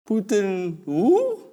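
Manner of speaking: very slow, rising joke reply; string out the reply playfully; mock-innocent reply; drawn-out putin who.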